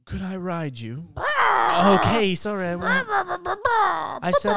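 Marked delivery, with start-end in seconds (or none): 1.2-4.5 high loud flustered protest; panicked high squeal; startled yelp. Acted. Bird reacts, rider backs off.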